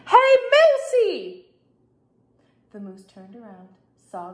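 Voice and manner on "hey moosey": high, loud, drawn-out call; yelling. A narrator's reading. Sisters hailing the moose across the yard, yelling. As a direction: yell to get the animal's attention. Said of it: "and yelled".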